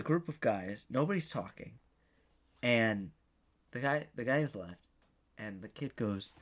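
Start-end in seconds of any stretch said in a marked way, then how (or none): none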